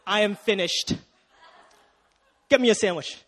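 loud, smug mock husband gloating; hammed-up smug victory. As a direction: gloat like a lazy new husband. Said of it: "he shuts the door and says".

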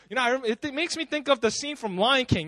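usual speaking voice

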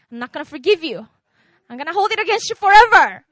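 loud, defiant grudge, building into a near shout; a mock grudge-holder shouting his refusal; vindictive outburst. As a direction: spit out the refusal to forgive as if holding power over him. Preacher voices a grudge-holder.

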